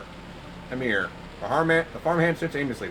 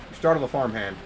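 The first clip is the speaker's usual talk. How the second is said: normal talking voice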